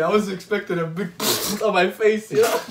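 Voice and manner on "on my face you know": ordinary delivery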